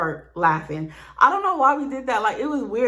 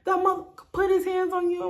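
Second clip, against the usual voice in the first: mock outrage, much higher pitch; dad screaming; 10 semitones higher than usual. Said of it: "He started screaming".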